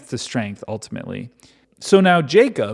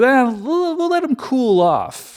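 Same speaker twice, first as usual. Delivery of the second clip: casual Jacob voice; 11 semitones higher than usual. Retold or imitated. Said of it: "He goes".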